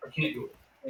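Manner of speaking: whispered repeat, under her breath. Retold or imitated. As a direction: drop to a whisper. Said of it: "But then she was like".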